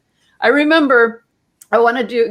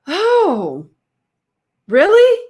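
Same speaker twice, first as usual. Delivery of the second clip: slow dawning "oh", then a high, louder "really?"; retold amazement, pitch leaping up; amazed; 9 semitones higher, 4 dB louder, much slower than usual. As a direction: act out astonished realization.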